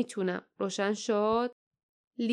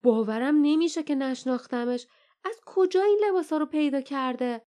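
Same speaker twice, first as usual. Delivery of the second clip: put-on higher boyish voice, disbelieving; Leif, amazed; 5 semitones higher than usual. A narrator's reading. Leif to friends about Jasmine, higher disbelieving voice. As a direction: say it like you still can't believe it. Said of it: "لیف برای بیستمین بار آهسته گفت".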